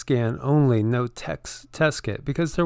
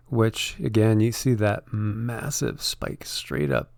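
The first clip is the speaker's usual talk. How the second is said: usual speaking voice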